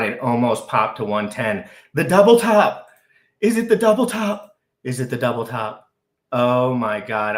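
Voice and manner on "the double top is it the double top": high, loud, excited squeal. Real, not acted. To the chat watching the double top, real excited squeal. Freaking out over chart.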